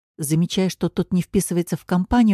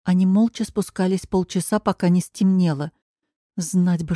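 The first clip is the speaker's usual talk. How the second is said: usual speaking voice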